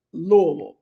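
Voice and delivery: Louisville rolled together as LOO-uh-vul; Louisville squeezed to LOO-uh-vul; local pronunciation. Shown as a demonstration. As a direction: say Louisville as one rolled word. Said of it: "you gotta roll your tongue".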